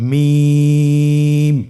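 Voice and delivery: মিম stretched very long and held with lips closed; drawn-out letter name. Shown as a demonstration. Teacher modelling recitation for students, long drawn-out মিম. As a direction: hold মিম for three counts, thin and loud. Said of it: "দুই ঠোঁট চেপে ধরে আমরা উচ্চারণ করব".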